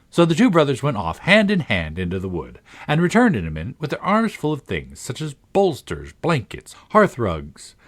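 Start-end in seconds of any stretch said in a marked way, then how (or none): none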